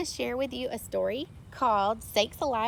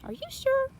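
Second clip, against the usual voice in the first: disbelieving question. A narrator's reading. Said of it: "sheriff asks in disbelief".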